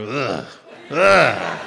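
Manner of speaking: disgusted ugh. Retold or imitated. Disgust at the almond butter.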